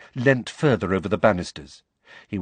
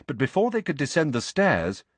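ordinary delivery, nothing marked

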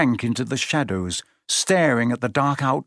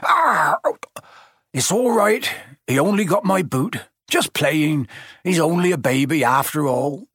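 higher, pained, making light of it; bitten Hagrid; 4 semitones higher than usual. A narrator's reading. Hagrid waving off the dragon bite, high and pained. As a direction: shrug off the bite.

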